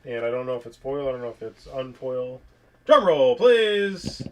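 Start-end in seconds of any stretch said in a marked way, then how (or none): 2.8-4.2 louder, higher drum roll call; announcer call. Pretend. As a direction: call it out like a showman. Announcing reveal.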